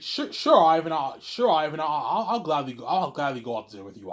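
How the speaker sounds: loud, high burst easing down as her; eager acceptance. Acted. Woman accepting his dinner invitation, eager and loud. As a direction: gush a stammered yes, starting high and loud.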